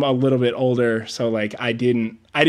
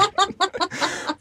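high laugh; 5 dB quieter than usual. Real, not acted. Laugh after fear.

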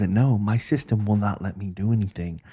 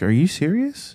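retold disbelief, pitched up high; incredulous; 8 semitones higher than usual. Call taker learns who caller's son is, acted incredulous. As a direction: act out a surprised question.

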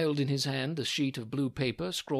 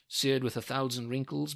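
plain reading voice

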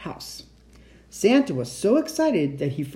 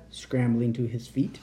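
ordinary delivery

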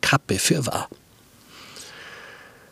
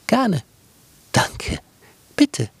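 put-on much higher chirpy girl; Brotkäppchen chirping politeness, high; polite girl; 11 semitones higher, slower than usual.

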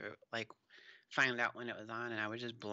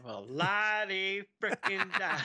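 slow, sneering la-di-da, louder and higher; mock the arrival in a drawn-out sarcastic sing-song; 7 semitones higher, 5 dB louder, slower than usual. Mocking character's arrival.

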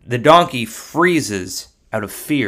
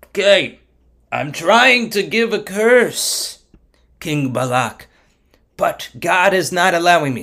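higher, strained explaining; flustered protest; 5 semitones higher than usual. Acted. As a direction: protest helplessly to a king. Frustrated Bilam explains to king.

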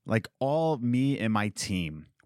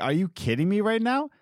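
higher, exasperated comeback; indignant retort; 10 semitones higher than usual. Retold or imitated. Retelling how she went off on the owner, indignant. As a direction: throw it back at him, incredulous. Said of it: "I went off on the owner".

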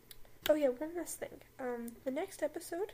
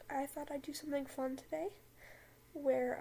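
usual speaking voice

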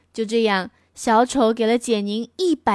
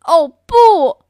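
much louder, higher acted yell; louder, higher father's yell; 6 semitones higher, 10 dB louder than usual. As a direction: shout it in alarm. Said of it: "爸爸大叫起来".

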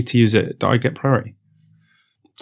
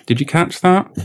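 normal talking voice

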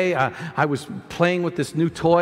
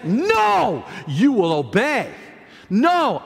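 firm command; 3 semitones higher, much slower than usual. Acted. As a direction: order the thought to submit. Commands a thought to obey.

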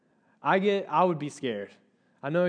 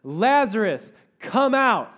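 loud, raised call, drawn out; commanding shout; 4 semitones higher, 7 dB louder, slower than usual. Acted. Jesus calling dead Lazarus from the tomb, shouted. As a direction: call him out with full command. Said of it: "And then Jesus shouted".

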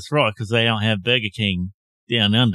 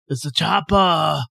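put-on Austrian accent; 7 semitones higher, 4 dB louder than usual. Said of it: "your Austrian".

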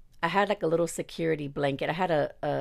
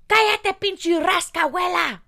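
high, loud mock-angry yell in Spanish; explosive retold comeback; fed-up shout; 8 dB louder than usual. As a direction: yell it to shut him down. Acts out finally snapping back.

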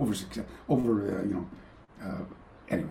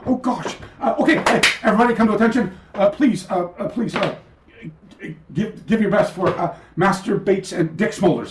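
higher, hurried call to attention; flustered announcement; 8 semitones higher than usual. Retold or imitated. Caught off guard, announcing the guests.

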